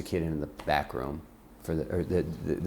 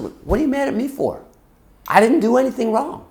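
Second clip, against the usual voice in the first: very high, loud protest; exasperated outburst; 10 dB louder than usual. Retold or imitated. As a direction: plead innocence at the top of your voice. Retold exasperated plea to screaming mom.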